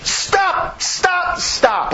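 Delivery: high repeated cry of stop, fading to plain; lightly voiced divine command, high then dropping; mock God commanding. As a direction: call out stop, stop, then let it sink. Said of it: "he's saying".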